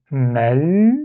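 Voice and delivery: "mal" ending on a held l; syllable closed on l. Shown as a demonstration. Teacher giving the first syllable, "mal" closed on a long l. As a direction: say "mal" slowly and stay on the l. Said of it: "La première syllabe se finit par".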